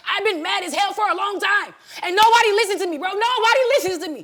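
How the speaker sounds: real anger. Real, not acted. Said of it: "Because I'm so mad right now".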